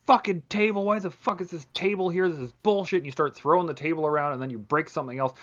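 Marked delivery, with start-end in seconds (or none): 0.1-3.0 higher pitched mock rant at the furniture; hammed-up toe-stub anger; mock frustrated. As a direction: complain like you just stubbed your toe. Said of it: "you stub your toe and you're like".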